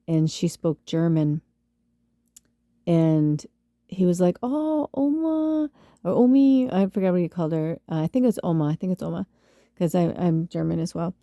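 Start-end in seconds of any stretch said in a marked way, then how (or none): 4.4-6.7 high, drawn-out greeting to Oma; acted phone greeting. Husband greeting his grandma on the phone, high and drawn out.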